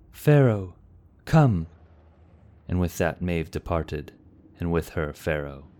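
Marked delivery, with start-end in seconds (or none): 0.1-1.7 loud, higher summons; calling out. A narrator's reading. Maeve calling Pharaoh to follow her, loud call. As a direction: call him to your side.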